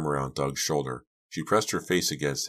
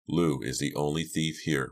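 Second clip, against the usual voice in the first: plain reading voice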